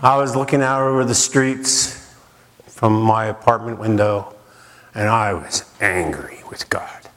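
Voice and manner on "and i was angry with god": a hushed, heavy admission; whispered confession. Real, not acted. Confessing his anger at God.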